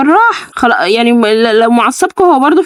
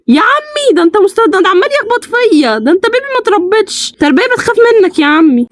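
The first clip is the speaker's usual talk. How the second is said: scolding; 8 semitones higher than usual. Real, not acted. Scolding the baby.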